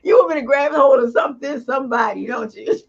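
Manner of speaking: high-pitched, amused accusation; teasing protest. Real, not acted. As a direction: call out the goading, playfully high. Teasing protest after laughing.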